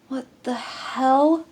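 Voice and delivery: 驚いて声を上げる; startled outburst. Acted. Salt found in his coffee.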